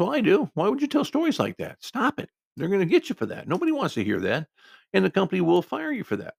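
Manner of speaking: high-pitched exasperated telling-off; exasperated scolding. Retold or imitated. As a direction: let him have it, voice climbing high in exasperation. Scolding coworker to stop.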